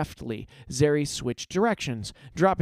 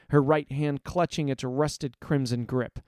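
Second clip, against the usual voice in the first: plain reading voice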